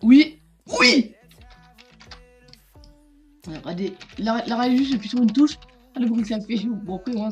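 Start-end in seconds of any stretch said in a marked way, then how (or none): 0.0-1.1 excited shout. Real, not acted. Winning the fight.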